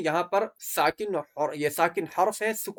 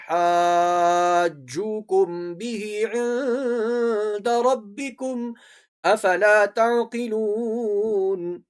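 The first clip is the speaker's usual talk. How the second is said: very slow chant with long held vowels; Quran recitation; 3 semitones higher, much slower than usual. Shown as a demonstration. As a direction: stretch each madd in a measured chant. Teacher recites the verse. (لمبی مد کے ساتھ تلاوت)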